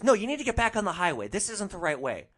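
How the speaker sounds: quick, high, loud mock scolding; bossy passenger. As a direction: imitate a rider overruling the driver. Passenger argues about the route.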